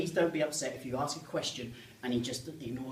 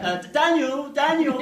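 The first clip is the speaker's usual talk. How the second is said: high, loud parental call; worried mother calling; 12 semitones higher, 11 dB louder, slower than usual. Acted. Mother calling her son from far off, anxious. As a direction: call a child back from afar, uneasy. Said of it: "She's like".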